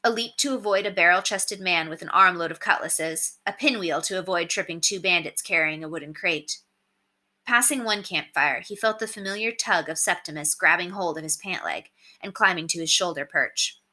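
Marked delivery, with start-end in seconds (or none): none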